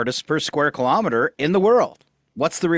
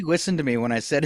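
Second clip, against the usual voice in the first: ordinary talk, nothing marked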